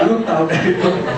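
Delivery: laughing through the words; laughing repeat. Real, not acted. The preacher repeats his father's reply, laughing with joy. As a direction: repeat the line while still laughing.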